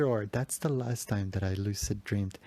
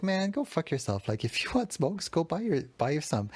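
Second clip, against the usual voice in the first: higher, joking snap back; mock annoyed cousin; 7 semitones higher than usual. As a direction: brush him off with a laugh.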